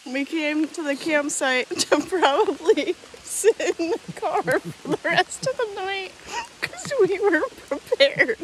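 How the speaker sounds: high, giggly voice broken by laughter; helplessly amused, words riding on laughter; can't stop laughing. Real, not acted. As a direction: struggle to get it out through real laughs. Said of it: "I can't stop laughing".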